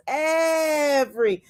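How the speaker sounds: "Every" drawn out slowly, each syllable crisp; lightly imitated friend saying "Every" syllable by syllable; enunciated every. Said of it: "she enunciates every vowel and syllable".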